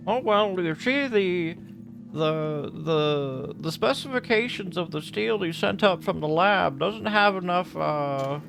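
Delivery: mock researcher picking at a lab's specifications; lightly voiced researcher. Acts out a department researcher complaining.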